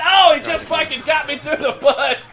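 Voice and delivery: excited outburst. Real, not acted. Commentator reacting to getting shot through a bush, excited outburst. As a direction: burst out as the play hits. Caught out during live game commentary.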